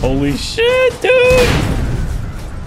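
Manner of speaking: high-pitched startled yelp, way up; shrieking in shock mid-game. Real, not acted. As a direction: jolt up into a squeal of disbelief. Sudden shock while gaming.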